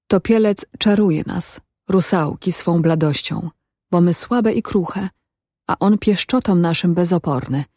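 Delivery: ordinary delivery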